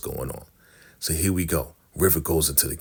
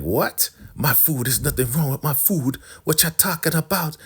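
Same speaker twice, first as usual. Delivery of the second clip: high put-on offended cook; imitated high-voiced outrage; mock indignant protest; 9 semitones higher than usual. Insulted cook defends her food.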